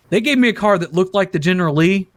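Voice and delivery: usual speaking voice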